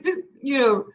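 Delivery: ordinary talk, nothing marked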